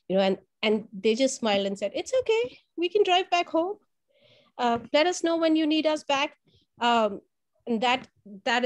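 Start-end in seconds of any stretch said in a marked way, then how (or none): 1.9-6.4 lightly voiced friendly nurse, higher; a kind easy reassurance; reassuring. Teacher imitating the kind nurses reassuring her, higher.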